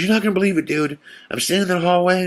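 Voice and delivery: high, breathless-sounding retold report; imitated freaking out. Frankie telling friends about the hallway, freaking out. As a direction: gush it out in mock agitation. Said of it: "freaking out".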